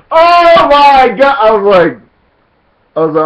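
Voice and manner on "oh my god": higher, slow, half-shouted through laughter; loud laughing oh my God; laughing disbelief. Real, not acted. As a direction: crack up in disbelief. Laughing disbelief at stepfather's move.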